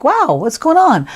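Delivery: high-pitched, mock-amazed voice; acted wide-eyed wonder; wowed surprise. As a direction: play pleasantly startled and curious. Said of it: "he's got kind of a like".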